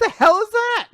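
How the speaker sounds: high, loud, mock-outraged; mock outraged squeal. Joking about Germany's name in a high indignant squeal. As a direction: squawk in silly indignation.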